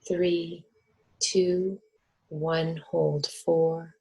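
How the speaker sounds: measured, steady counting with clear pauses; guided breathing count. Real, not acted. Coaching the group through held breaths, steady meditation count. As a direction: count them evenly through each breath.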